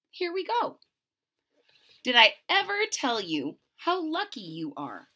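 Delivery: ordinary delivery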